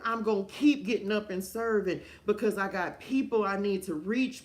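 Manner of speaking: high, loud, fervent sermon voice; full-force preaching. Acted. Preaching to viewers on the live, full force. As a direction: preach it out with fire to the crowd.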